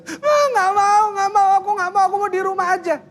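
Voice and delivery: put-on high, wailing little kid refusing school; child crying to a parent at the school gate, high whiny wail. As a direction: whine like a child clinging at the gate. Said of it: "Di depan gerbang nangis".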